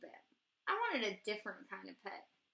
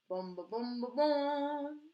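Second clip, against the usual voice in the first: booming oom-pah, louder on the last boom; tuba imitation; 5 dB louder, slower than usual. The boy playing tuba at his music lesson, booming. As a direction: mouth the tuba's beat.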